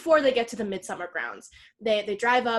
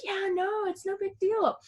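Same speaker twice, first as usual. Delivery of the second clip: mock casual reassurance; 8 semitones higher, 4 dB quieter than usual. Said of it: "And they were like".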